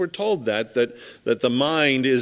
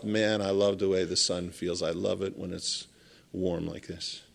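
much lower and much softer, savoring the sun; dreamy inner thought; 6 semitones lower, 8 dB quieter than usual. Retold or imitated. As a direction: murmur it like a man basking in warmth. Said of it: "thought to himself".

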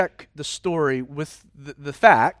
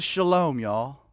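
southern drawl; 7 semitones lower, 6 dB quieter, slower than usual. Retold or imitated. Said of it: "in his southern drawl".

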